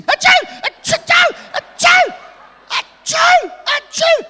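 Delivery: high, loud mock sneezes in a row; fake loud sneezes. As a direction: sneeze theatrically, over and over. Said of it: "I'd start sneezing".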